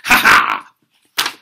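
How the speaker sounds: sudden loud mocking ha ha; loud scornful laugh. Real, not acted. Ranting man laughs loudly at the court's appeal offer. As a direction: laugh in their face.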